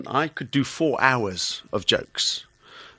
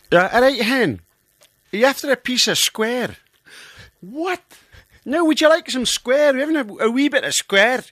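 high-pitched Scots mother-in-law; Scottish accent; 10 semitones higher, 4 dB louder than usual. Acted. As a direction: offer it in broad, lilting Scots. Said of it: "I was married to a Scots girl".

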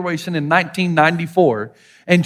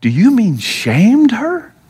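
slow, louder, incredulous question; shocked; 3 semitones higher, 5 dB louder, much slower than usual. Retold or imitated. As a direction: imitate a translator aghast at the news. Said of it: "in shock and said".

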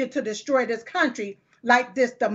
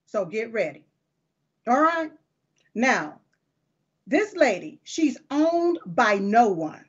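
usual speaking voice

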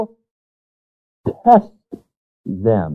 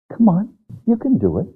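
gentle encouragement; 3 semitones higher than usual. Acted. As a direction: urge them on, kindly. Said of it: "Speaks very gently to me".

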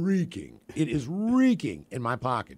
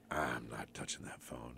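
low hushed voice, frozen with fear; wife hushed and paranoid; paranoid whisper; 13 semitones lower, 15 dB quieter than usual. Retold or imitated. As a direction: whisper as if the phone can hear.

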